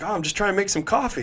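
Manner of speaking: high, fast, clueless tone; innocent guy voice. Retold or imitated. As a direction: act oblivious and chirpy. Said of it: "And he's like".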